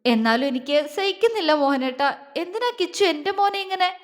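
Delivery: mother's anguished high-pitched plea; acted tearful lament, climbing in pitch; faltering, distressed. A narrator's reading. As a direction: let the words falter, rising towards tears. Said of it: "അവരുടെ വാക്കുകൾ ഇടറി".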